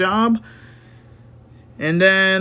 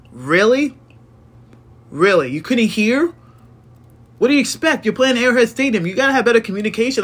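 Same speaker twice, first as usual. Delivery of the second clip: exasperated rant; 5 semitones higher, faster than usual. Real, not acted. Ranting about officials' clock mistake.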